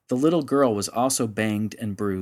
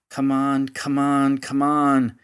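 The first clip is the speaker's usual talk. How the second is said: grumbling; 4 semitones higher, 5 dB louder, slower than usual. Acted. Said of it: "he grumbled as he paced".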